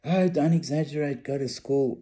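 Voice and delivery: mother telling son to stop fussing. Retold or imitated. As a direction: wave away the drama as mum. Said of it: "She was going".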